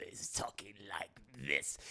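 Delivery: low, soft whispery villain rasp; Palpatine. Retold or imitated. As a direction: drop into a hushed, sinister croak. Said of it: "Palpatine's voice changes here".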